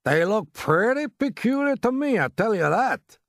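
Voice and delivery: high, loud, drawn-out exclamation; alarmed onlooker. A narrator's reading. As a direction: call it out slowly and emphatically. Alarmed onlooker with binoculars calls out.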